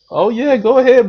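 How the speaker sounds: mocking, high-pitched go-ahead; sarcastic high mock approval; mock eager permission. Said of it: "mocking the soft boyfriend".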